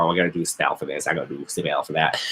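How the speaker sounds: fast, soft, rushed mock whine; mocking impatient witch. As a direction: rattle it off like a needy beginner.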